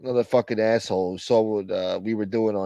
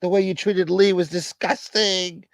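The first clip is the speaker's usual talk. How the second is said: mock angry caller; 7 semitones higher, 3 dB louder than usual. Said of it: "There's plenty of room left for".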